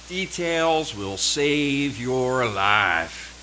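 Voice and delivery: very slow, deliberate drill-instructor line; teacher's drilled maxim. Retold or imitated. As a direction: spell each word out like a maxim hammered in. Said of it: "repeated by my teacher so often".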